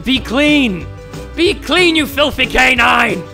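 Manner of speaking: loud mock command at the puppy; commanding shout. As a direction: bark the order at a dirty dog. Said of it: "that wasn't too feminine of me".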